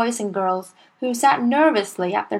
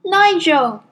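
put-on a high, timid child's answer; small boy; 8 semitones higher, 5 dB louder than usual. A narrator's reading. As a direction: answer like a nervous little boy.

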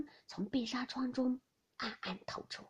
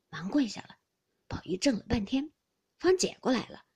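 ordinary delivery

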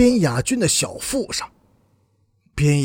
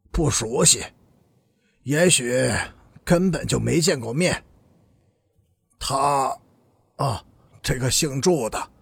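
reluctant; 5 semitones lower than usual. A narrator's reading. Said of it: "才十分不肯地说".

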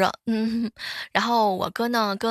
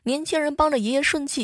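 ordinary talk, nothing marked

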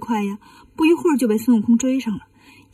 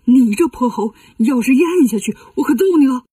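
louder, alarmed scolding; flustered threat; 4 dB louder than usual. Acted. Laojun grabs Wukong as he eyes the pill, flustered threat. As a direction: warn the rascal with real force. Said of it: "太上老君看了就慌了".

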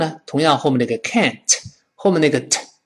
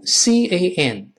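slow letter-by-letter spelling; 5 semitones higher, much slower than usual. Shown as a demonstration. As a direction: spell it slowly for the class. Teacher spells out the word.

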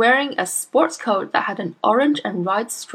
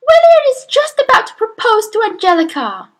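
shrill, high mother voice; 9 dB louder than usual. A narrator's reading. Mother fixated on TV show.

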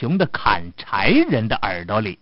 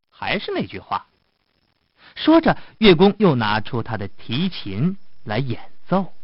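ordinary delivery, nothing marked

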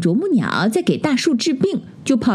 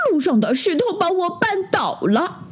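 high, mock-crying bear cub; tearful complaint; 10 semitones higher than usual. A narrator's reading. Little bear blames the stone, high mock-tearful voice. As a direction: whine it high, pretending to cry. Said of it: "小熊哭得更伤心了".

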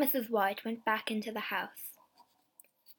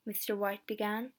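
ordinary delivery, nothing marked

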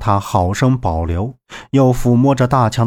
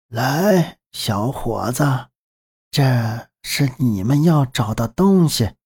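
put-on old woman voice; slower than usual. Old grandmother character speaks.

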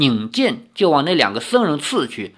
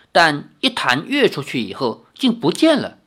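plain reading voice

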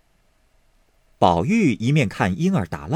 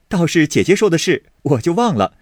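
louder, higher, suddenly delighted; 7 semitones higher, 5 dB louder than usual. Acted. Baoyu clapping his hands at Baochai's idea, delighted. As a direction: struck by a bright idea, eager. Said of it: "便拍手笑道".

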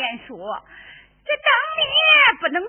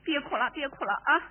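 normal talking voice

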